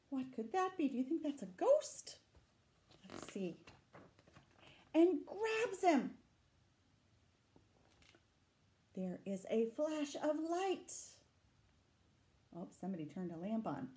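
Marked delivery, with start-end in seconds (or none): 4.9-10.9 higher, chase-like narration; reading the chase to children, higher and eager; excited story reading. A narrator's reading. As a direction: urge them on after the beast. Reading story text dramatically.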